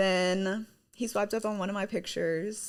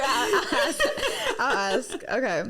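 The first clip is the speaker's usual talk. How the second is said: ordinary talk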